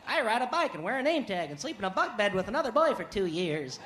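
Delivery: eager missionary voice, swooping pitch; put-on missionary pitch. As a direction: recite it like an earnest young missionary.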